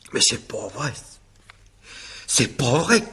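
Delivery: rising, breaking disbelief; pained, rising cry of disbelief; distraught denial. Acted. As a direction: refuse to believe she's gone. Husband breaks down over dead wife.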